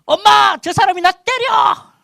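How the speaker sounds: put-on very high, loud child's wail for mom; acted tattling shriek, drawn out; frightened child crying out. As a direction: yell for mom like a scared kid. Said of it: "두려워지면서 집으로 쫓아 들어가면서".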